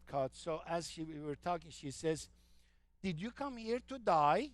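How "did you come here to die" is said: put-on Australian accent, "die" for "today". Nurse asks patient in Australian accent, "today" as "to die". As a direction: say "to die" the Aussie way, meaning today. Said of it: "The pronunciation".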